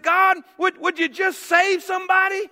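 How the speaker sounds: high, loud, exasperated cry to God; frustrated plea. Retold or imitated. Preacher re-enacting his prayer to God, frustrated plea. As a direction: plead loudly, at the end of your patience. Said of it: "I was just so frustrated".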